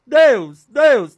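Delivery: loud, high cries of "Deus"; loud calling. Shown as a demonstration. Preacher tries out names for God, loud calling. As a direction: call "Deus" out high and loud, falling each time. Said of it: "Assim fica mais".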